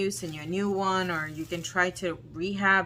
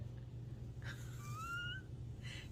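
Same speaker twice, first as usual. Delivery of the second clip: tearful laugh; 16 dB quieter than usual. Real, not acted. Upset laughing cry.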